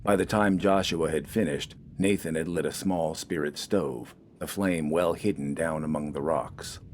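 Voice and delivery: ordinary delivery, nothing marked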